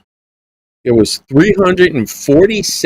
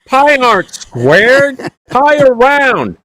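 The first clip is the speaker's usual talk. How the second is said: slow, high hillbilly dad delivering the joke; country dad correcting his college son's math, slow and high-pitched; Jed Clampett punchline; 10 semitones higher, much slower than usual. Retold or imitated. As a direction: draw it out slowly, way up high, like a backwoods father correcting his son. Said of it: "you sounded like there was Jed Clampett and Jethro".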